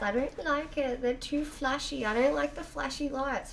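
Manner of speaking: put-on high whiny child voice; little girl voice. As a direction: whine it like a small kid. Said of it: "she goes".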